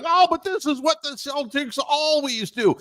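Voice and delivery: high sneering mock pundit voice; high mocking whine. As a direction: parody the Celtics excuse. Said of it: "and it's like".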